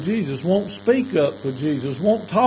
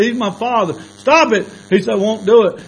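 higher, louder back and forth; heated exchange; 7 semitones higher, 7 dB louder than usual. Retold or imitated. Two workers arguing, raised angry voices. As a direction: snap back in the quarrel.

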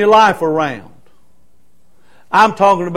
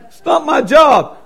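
retold firm shout; 7 semitones higher than usual. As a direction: plant your feet and bark it out slowly. Pastor retells telling off young man.

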